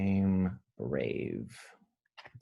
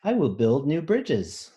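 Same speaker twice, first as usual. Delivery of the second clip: bold, proud storybook read; reading a picture book aloud; 4 semitones higher, 3 dB louder than usual. A narrator's reading. Teacher reading a picture book to children, bold and proud. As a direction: read it out like a brave promise.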